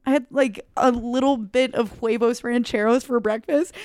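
normal talking voice

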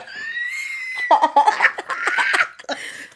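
long high shrieking laugh; prolonged helpless cackle; real laughing fit. Real, not acted. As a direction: lose it completely, laughing loud. Cracks up at own botched accent.

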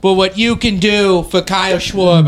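put-on JFK-style Boston accent, oratorical. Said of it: "that is my Boston accent. It's just J F K".

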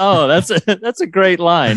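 high, laughing praise through giggles; laughing delight. Retold or imitated. Podcast host laughing, recalling his own reaction, delighted. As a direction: gush about the line while laughing. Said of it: "I was like".

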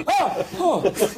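high, small mock gasps of fright; startled. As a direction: act a quick comic startle. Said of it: "he gets startled each time".

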